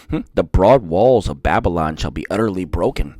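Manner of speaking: low, slow, solemn Bible reading; scripture reading. Acted. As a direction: intone the prophecy gravely. Reads scripture aloud.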